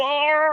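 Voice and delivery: high gagging wail; mock choking cry. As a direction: gag and cry out under the hose. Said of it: "you're going".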